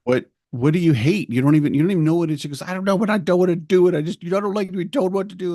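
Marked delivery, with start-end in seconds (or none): 0.0-2.4 fast, higher and louder disbelief; mildly hammed-up frustrated parent; exasperated parent. Parent arguing with upset child. 2.6-5.5 put-on high, fast voice of a stubborn kid; imitating the daughter refusing taekwondo; whiny child. Voicing ten-year-old daughter's complaint.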